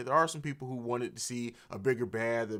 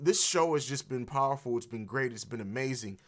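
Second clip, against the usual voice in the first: ordinary talk, nothing marked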